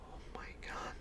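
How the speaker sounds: awed hushed whisper. Retold or imitated. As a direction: breathe it out in shock. Girlfriend awed, eyes closed.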